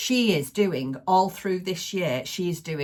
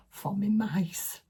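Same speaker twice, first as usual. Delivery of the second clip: whisper; 4 semitones higher, slower than usual. Real, not acted. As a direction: lean in and whisper it like a secret. Secret excitement about the mice.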